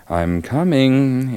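slow, high sing-song call. Acted. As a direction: draw it out singing. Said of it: "in her sing-song voice".

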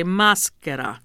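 maschera with the weight on "ma"; stress on first syllable. Shown as a demonstration. Singer drilling the host, first-syllable stress on "maschera". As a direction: lean on the opening "ma" of "maschera". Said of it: "you want the accent on the ma".

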